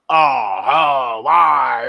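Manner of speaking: low, drawn-out mock groan; mock dismayed reaction. Imitating people's first reaction on hearing such things, mock dismayed groan. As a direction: groan it out slowly, hamming up the dismay. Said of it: "The first reaction is".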